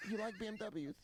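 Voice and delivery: Mike Tyson. Retold or imitated. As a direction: pitch it up, chatting up girls as the boxer. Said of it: "Why does Mike Tyson".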